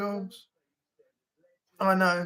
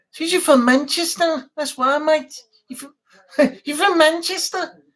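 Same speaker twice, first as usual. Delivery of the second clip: higher, louder mock Mancunian accent; put-on Mancunian; 4 semitones higher, 4 dB louder than usual. Streamer asking if you're from Manchester, hammed-up Mancunian accent. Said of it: "I only speak Mancunian".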